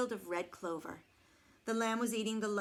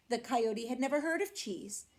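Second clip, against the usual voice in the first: ordinary delivery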